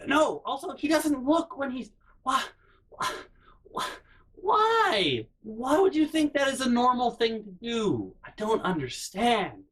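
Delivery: high-pitched, baffled protest; can't believe what she's hearing; exasperated disbelief. Real, not acted. Speaker exasperated at mom's reaction.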